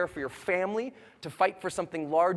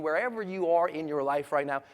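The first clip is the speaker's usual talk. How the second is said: ordinary talk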